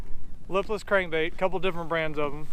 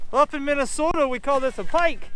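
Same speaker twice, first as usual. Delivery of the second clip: a hammed-up Minnesota accent, pitched higher; bad Minnesota accent; 7 semitones higher than usual. Angler joking to his buddy in a bad Minnesota accent. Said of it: "That was a bad Minnesota accent".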